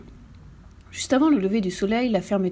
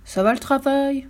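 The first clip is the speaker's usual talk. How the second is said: high, slow farmer's call; drawn-out call, mildly shouted; shouting farmer; 5 semitones higher than usual. A narrator's reading. Lazy farmer calling to the duck at work, drawn-out mild shout. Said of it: "criait le fermier".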